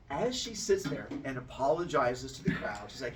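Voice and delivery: usual speaking voice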